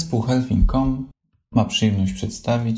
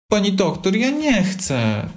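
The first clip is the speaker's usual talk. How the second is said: high, slow protest; a sick little fox pleading, much higher; 11 semitones higher, slower than usual. Acted. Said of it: "protestował pokasłując Lisek". (protestując, wysoko i powoli)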